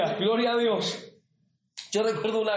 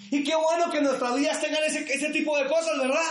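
higher, full-voiced pulpit preaching; fervent preaching; 9 semitones higher than usual. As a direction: preach it with rising fervour. Preaching to the congregation. (predicación ferviente en voz alta)

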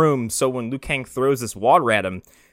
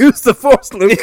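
high, loud hammy wise mentor; mock Obi-Wan voice; 11 semitones higher, 12 dB louder than usual. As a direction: ham up the old Jedi. Mock Star Wars quote.

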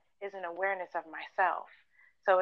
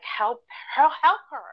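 higher, louder urgent repeat that builds; urgent inner voice; 7 semitones higher, 7 dB louder than usual. Retold or imitated. Her inner voice urging her to help the homeless woman, rising urgent call. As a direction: let the inner voice rise and push harder on the second. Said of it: "something in me was just like".

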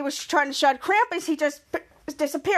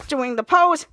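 ordinary delivery

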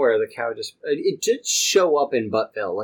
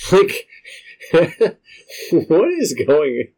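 loud, breaking up with laughter; incredulous laughing; 5 semitones higher, 6 dB louder, much slower than usual. Real, not acted. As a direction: laugh through it, baffled and higher. Cracks up at the manual's text.

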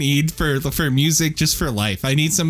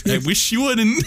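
laughing; 8 semitones higher than usual. Real, not acted. Echoing friend's words while laughing.

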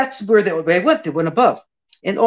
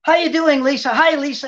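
high, breezy star hello; confident star greeting; 8 semitones higher than usual. Retold or imitated. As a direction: greet her like an old friend. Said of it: "like I owned it".

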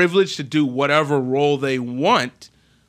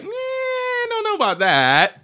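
long high whiny "Eh", then drawn-out scepticism; mock doubt. Host voicing everyone's reaction to Scarlett's statement, mock doubt. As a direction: stretch it out as a sceptical crowd.

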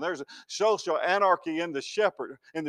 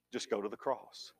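barely above a breath, lower and quieter; softer still; 6 semitones lower, 14 dB quieter than usual. Real, not acted. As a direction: let it fall away, tender. Repeated softly for emphasis.

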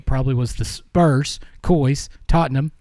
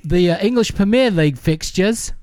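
mock English accent; 7 semitones higher than usual. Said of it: "I don't know if that's good or not".

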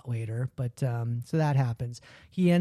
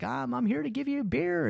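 high sheepish explaining; 9 semitones higher, 4 dB quieter than usual. Retold or imitated. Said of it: "He's like".

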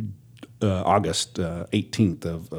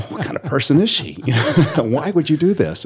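high, louder indignant mock fury; mock outrage; 8 semitones higher, 5 dB louder than usual. Husband mock-raging that wife hid his gun. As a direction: play the betrayed husband, laughing. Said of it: "Now I'm mad".

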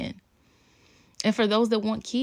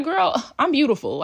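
confident little daughter; 7 semitones higher, 5 dB louder than usual. Retold or imitated. Voicing confident young daughter.

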